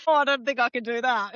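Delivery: high-pitched imitation of backing out; playfully acted social nerves; mock reluctance. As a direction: squeal out a hammed-up refusal. Acted reluctant networker, retold.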